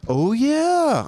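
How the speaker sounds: high, loud "oh, yeah" of dawning shock; startled realization, high and loud; sudden realization. Retold or imitated. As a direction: realization hits, shouted high. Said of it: "So you don't see six six six".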